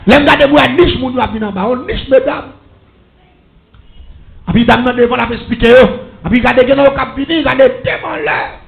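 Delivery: prêche crié, plein de ferveur; proclame-le à toute la salle; sermon prêché à pleine voix. Acted. (prédication criée avec ferveur)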